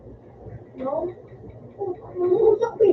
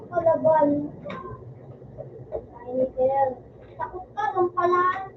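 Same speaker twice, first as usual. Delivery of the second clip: ordinary delivery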